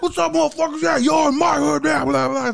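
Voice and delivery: high shouted jailhouse taunt; hammed-up inmate yelling; mock barking. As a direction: bark it at the scared kids. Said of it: "He starts barking at us".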